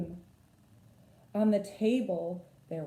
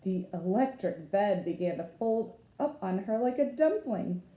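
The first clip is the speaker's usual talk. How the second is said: ordinary delivery, nothing marked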